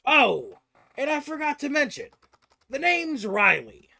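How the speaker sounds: high, loud character voice; self-introducing dwarven stranger. Acted. Riley introduces himself to the party, showy afterthought.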